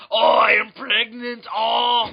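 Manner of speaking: slow, loud, hammed-up action-star groan; Arnold Schwarzenegger. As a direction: wail it like a straining Austrian muscleman. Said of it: "random Arnold Schwarzenegger movies".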